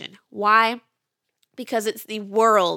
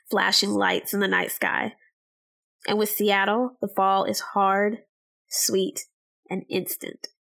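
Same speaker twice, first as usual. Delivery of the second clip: story narration. Acted. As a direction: read it like a travel memoir. Dreamy narrated story intro.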